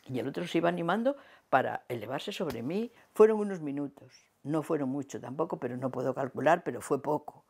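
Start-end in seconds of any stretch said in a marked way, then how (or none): none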